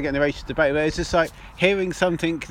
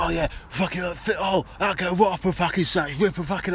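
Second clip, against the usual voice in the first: laddie after beers; 3 semitones higher than usual. Retold or imitated. Friend retelling their own chat about sex shops, mock laddie. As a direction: lightly act a lad a few beers in. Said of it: "proper sort of really laddie kind of after a few beers".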